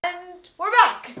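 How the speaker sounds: announcer voice. Pretend. Returning after a pause.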